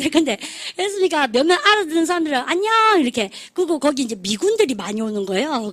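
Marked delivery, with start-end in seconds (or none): 2.4-3.0 high, drawn-out called greeting; imitated fans' reply. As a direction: hail the singer from the crowd, pitched up. Few fans who understood answer singer.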